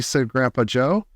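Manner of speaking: ordinary delivery, nothing marked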